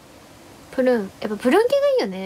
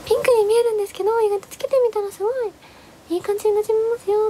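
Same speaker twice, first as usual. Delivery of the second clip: higher and fast clerk patter; imitating the clerk's pitch; 9 semitones higher, faster than usual. Clerk reassuring about pink color, quick high clerk patter. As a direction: rattle it off high like a chatty clerk.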